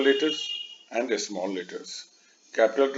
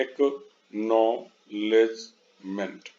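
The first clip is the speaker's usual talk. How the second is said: Acknowledgement slowly in four separate beats; split into syllables; 2 semitones lower, much slower than usual. Shown as a demonstration. Teacher repeating the long word, slow and split. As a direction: say acknowledgement piece by piece, एक्, नॉ, लेज, मेंट. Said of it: "इसमें तीन से अधिक syllables है".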